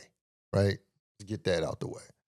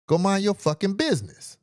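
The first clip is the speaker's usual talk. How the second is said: retold brush-off; 10 semitones higher than usual. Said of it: "And I was like".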